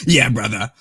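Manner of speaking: loud gruff wrestler growl; hammed-up wrestling promo shout; Hulk Hogan voice. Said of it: "Like Hulk Hogan".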